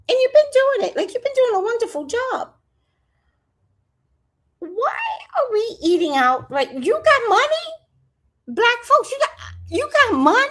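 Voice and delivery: high, loud scolding of the audience; mock scolding. Real, not acted. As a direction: scold them up high like a disappointed auntie.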